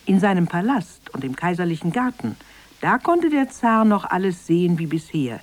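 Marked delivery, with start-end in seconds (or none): none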